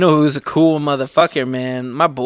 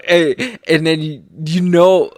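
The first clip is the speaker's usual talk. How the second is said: ordinary talk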